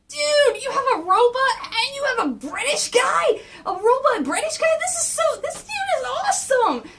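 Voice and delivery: high, loud fanboy gushing; excited Mikey. Retold or imitated. Mikey gushing over Alfred and the Batmobile crew, high excited squeal. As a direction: squeal it high, thrilled and amazed. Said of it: "Mikey's going like".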